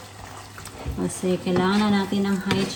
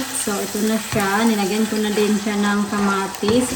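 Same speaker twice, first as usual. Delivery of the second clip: usual speaking voice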